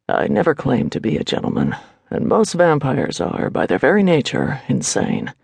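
warrior priest's voice, dropping low at the end; measured male hero, sinking onto the last word; Alaric, dry retort. A narrator's reading. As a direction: answer coolly in a put-on man's voice.

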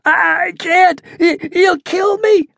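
high, slow stammering terror; frightened stammer. A narrator's reading. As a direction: stutter it out, scared stiff. Terrified captive pleading.

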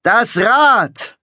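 high, loud cry; shouting. A narrator's reading. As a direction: scream it out over the crowd. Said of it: "schrie Orphyreus".

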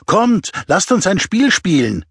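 high, eager call for a game; a tipsy girl begging for a game; fordernde junge Frau. A narrator's reading. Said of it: "Die Stimme einer jungen Frau forderte". (angeheitert und fordernd)

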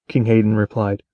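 ordinary delivery

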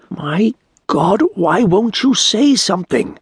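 high, slow, strained plea of a hooded stranger; pleading stranger. Acted. Hooded stranger begging Turi for help, high and pleading.

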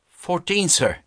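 put-on high, simple boy's answer; earnest poor boy. A narrator's reading. Boy answers the stern master, lightly voiced earnest boy.

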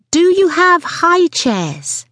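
slow, high, drawn-out demand; impatient repeat. Acted. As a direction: stretch each word at an inattentive waitress. Second take, impatient repeat.

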